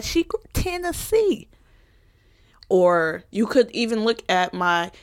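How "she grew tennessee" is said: Southern twang on Tennessee; country accent. Real, not acted. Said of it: "I got the country accent when I just said Tennessee".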